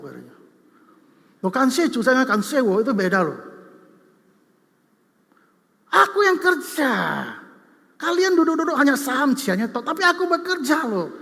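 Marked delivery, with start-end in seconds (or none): 1.4-11.1 loud, higher, indignant complaint; the brother who worked the ponds, angrily protesting to his siblings; angry younger brother. Retold or imitated. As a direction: complain hotly that you did all the work. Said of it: "Marah dia".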